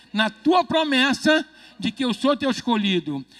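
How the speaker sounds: mais alto e devagar, proclamando; proclamação de fé. Shown as a demonstration. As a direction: declare cada palavra com força. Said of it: "Então fala comigo assim". (pastor puxando a oração, alto e lento)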